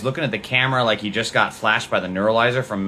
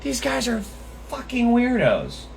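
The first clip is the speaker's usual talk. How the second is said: exasperated; 11 semitones higher, slower than usual. Real, not acted. Exasperated at the threats.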